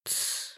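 короткое тихое «тсс»; шикнуть, призывая замолчать; шикает. A narrator's reading. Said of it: "шикнула мама". (цыкнуть тихо, по-матерински строго)

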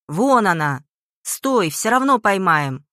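loud pursuer's shout, drawn out. A narrator's reading. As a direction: shout after someone you are chasing. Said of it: "громко крича". (громкий крик вдогонку)

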